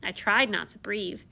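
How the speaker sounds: ordinary delivery, nothing marked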